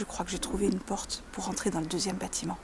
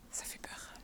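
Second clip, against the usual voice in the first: whispering; 14 dB quieter than usual. Real, not acted. Sneaking toward the guard's house.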